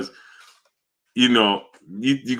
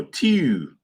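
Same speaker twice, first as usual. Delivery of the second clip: Baltimore "two", vowel fronted to "tew"; Baltimore accent; 3 semitones higher than usual. Retold or imitated. Streamer showing how Baltimore talks, in a Baltimore accent. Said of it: "in Baltimore".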